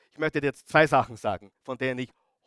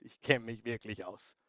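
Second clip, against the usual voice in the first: much softer, low and firm; quiet insistence; 5 semitones lower, 11 dB quieter than usual. Acted. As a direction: drop the voice to drive it home. Quiet insistence after repeat. (leise, tief und bestimmt)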